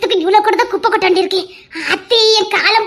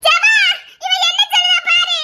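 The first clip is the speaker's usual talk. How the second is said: laughing outcry; 7 semitones higher than usual. Acted.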